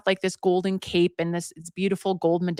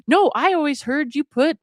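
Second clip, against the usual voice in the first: higher-pitched contrary comeback; mock arguing; 7 semitones higher, 4 dB louder than usual. Partner answering back in garden quarrel, insistent. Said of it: "they're arguing in their garden".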